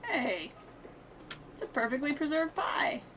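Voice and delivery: ordinary talk, nothing marked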